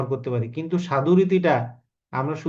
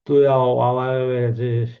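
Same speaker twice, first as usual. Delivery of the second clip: film dialogue; 3 semitones lower, 4 dB louder than usual. Retold or imitated. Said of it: "মান্নার মুভিতে dialogue গুলো এরকম".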